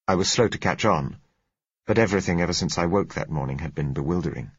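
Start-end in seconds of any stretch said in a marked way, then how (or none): none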